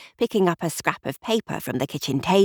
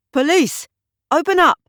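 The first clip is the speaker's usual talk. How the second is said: much higher, louder commanding shout; police shout; 11 semitones higher, 6 dB louder than usual. A narrator's reading. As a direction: bark at the locked cabin door. Police at cabin door.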